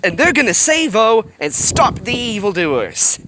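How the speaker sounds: rally the viewers like a hype announcer; real enthusiastic build-up; hyped up. Real, not acted. Reader hypes heroes to viewers.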